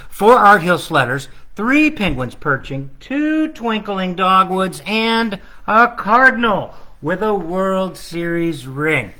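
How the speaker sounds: sing-song counting rhythm, each number leaned on; carol countdown. Acted. Illustrator reading his book's countdown in sing-song. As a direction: recite it like the carol.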